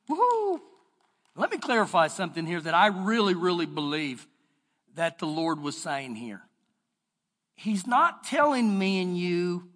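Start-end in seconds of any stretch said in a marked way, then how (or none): none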